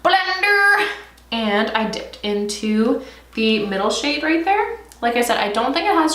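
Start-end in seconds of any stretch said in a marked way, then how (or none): 0.0-0.9 BLENDER sung out high and drawn out; playful exclamation. Real, not acted. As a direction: call out "blender" in a high, silly voice. Playful repeat of the word.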